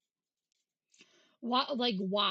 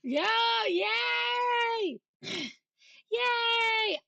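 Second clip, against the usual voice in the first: high shouted yay; cheer; 10 semitones higher, 6 dB louder, much slower than usual. Pretend. Streamer cheering at spinach talk, high happy shout. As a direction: squeal it out like a delighted kid.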